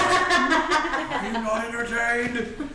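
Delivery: slow, laughing arena cry; mock gladiator. GM playfully quoting Gladiator to players, slow and hammed up. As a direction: ham up the gladiator line, laughing.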